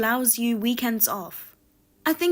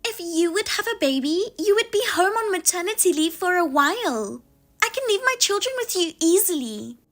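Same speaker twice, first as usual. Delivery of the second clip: higher, huffy sister-in-law voice; indignant sister-in-law; 5 semitones higher than usual. Acted. Sister-in-law snapping at the narrator over babysitting, higher and indignant. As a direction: play the entitled sister-in-law taking offense.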